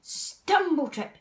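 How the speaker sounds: ordinary delivery, nothing marked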